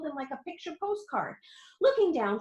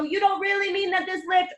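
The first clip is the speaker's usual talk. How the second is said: alarmed shout; 8 semitones higher, 4 dB louder than usual. A narrator's reading. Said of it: "shouted Grandpa Joe".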